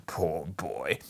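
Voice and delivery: pitying. A narrator's reading. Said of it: "between laughing and crying".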